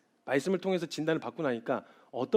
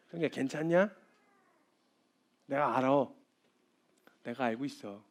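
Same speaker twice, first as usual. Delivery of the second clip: tender comfort; 4 dB quieter than usual. Retold or imitated. The Lord comforting quietly.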